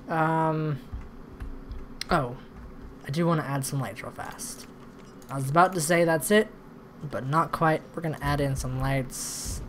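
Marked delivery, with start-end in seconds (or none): none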